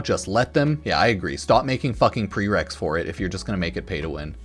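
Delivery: ordinary delivery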